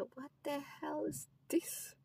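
slow, breathless whisper of disbelief; shocked whisper. Retold or imitated. Catching the student smoking, a slow stunned whisper. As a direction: whisper it slowly, stunned at what you see.

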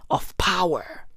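normal talking voice

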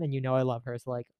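ordinary talk

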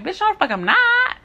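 sharp high indignant comeback; retold retort. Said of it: "And I was like".

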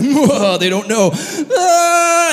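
loud, high, hammed-up bawling; comic exaggerated sobbing; mock weeping. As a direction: wail through the words, then burst out crying. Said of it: "he starts weeping".

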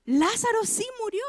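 sudden high, shocked "¿Qué?"; surprised disbelief. Retold or imitated. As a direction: jump up in pitch with disbelief. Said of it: "pongo esa cara así como". (incredulidad sorprendida, aguda)

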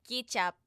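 "ketchup" said as KEE-chap; accented "ketchup". Shown as a demonstration. Co-host imitating Filipino speech, "ketchup" as KEE-chap. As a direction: say "ketchup" loudly with a long ee. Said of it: "household items coming out of their mouth".

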